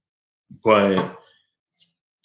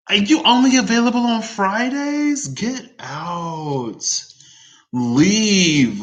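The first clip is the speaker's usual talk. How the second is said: high-pitched, hammed-up indignation; mock outrage; 13 semitones higher, 5 dB louder, slower than usual. Vlogger scolding the Friday-only booking slots, mock outrage. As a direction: squeal in disbelief, then banish them theatrically.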